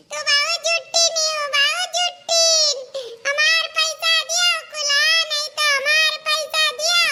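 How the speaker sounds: put-on high, insistent little boy demanding his money; child voice whining at mother; whiny child. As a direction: stamp your foot like a kid.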